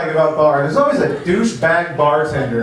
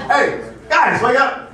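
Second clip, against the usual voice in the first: mock calling out; 9 semitones higher, slower than usual. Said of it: "They're upstairs".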